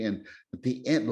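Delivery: ordinary talk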